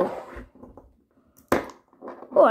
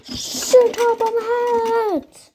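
slow drawn-out call; 4 semitones higher, 5 dB louder, much slower than usual. Acted. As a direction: call out across the room. Calling out to Sir Top Hat.

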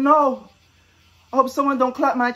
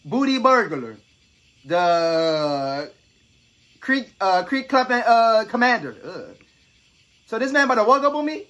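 usual speaking voice

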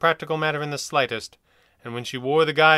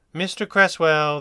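slow, louder commanding address; firm, louder authoritative doctor; with authority; 4 semitones higher, 6 dB louder, slower than usual. Acted. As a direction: call his name like you own the room. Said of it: "his voice betraying more authority".

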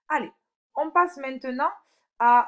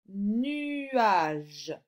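long, drawn-out vowels; slow dictation. Shown as a demonstration. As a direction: stretch the word for a young pupil. Teacher dictating words for sound exercise. (mot étiré, dicté lentement)

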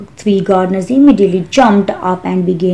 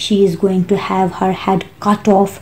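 usual speaking voice

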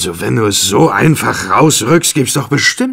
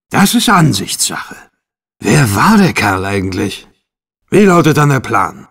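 ordinary delivery

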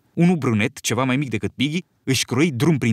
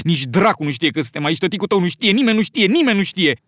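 panicky rising fear; 6 semitones higher, 3 dB louder than usual. Acted. Piggy panics, stranded on island.

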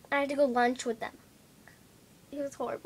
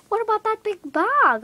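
higher, louder pushy lady voice; child mimics a pushy lady, higher and louder; high mock lady; 10 semitones higher, 8 dB louder than usual. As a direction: do the nosy lady pointing at the bag. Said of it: "And she's like".

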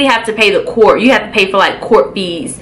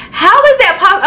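high, squeaky disbelief; asking it in pitched-up shock; 10 semitones higher, faster than usual. Retold or imitated. Asking the officers about the warrant, pitched-up disbelief.

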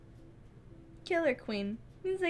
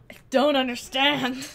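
loud disbelief; 9 dB louder than usual. Real, not acted. Baffled at chat's comment.

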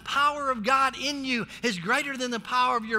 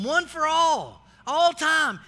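ALL hit loud; emphatic proclamation; 3 semitones higher, 5 dB louder, slower than usual. Real, not acted. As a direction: stress "all" loudly. Preaching emphasis.

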